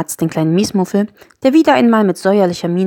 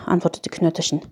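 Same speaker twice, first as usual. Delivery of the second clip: ordinary delivery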